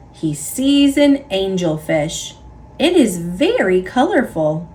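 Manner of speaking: ordinary delivery, nothing marked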